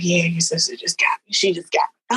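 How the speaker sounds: hushed whisper; secretive whisper to a friend; whispered confiding. Retold or imitated. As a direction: whisper it so the others cannot hear.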